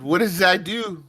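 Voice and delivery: high-pitched, bad mock French accent. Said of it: "That was my really shitty French accent".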